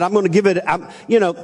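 ordinary delivery

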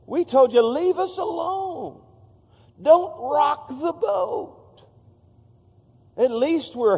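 high, slow whiny mock complaint; high drawn-out mocking whine; mocking Israelites. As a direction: sneer it like grumbling people who want to be left alone. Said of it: "They just said".

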